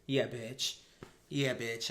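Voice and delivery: ordinary talk, nothing marked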